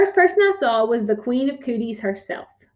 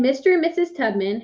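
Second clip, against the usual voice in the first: ordinary delivery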